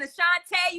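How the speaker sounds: Ashanti said as "ah-shahn-TAY"; imitated mispronunciation. Singer teasing host's rhyming, Ashanti mispronounced to end in "tay". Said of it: "you trying to rhyme and shit".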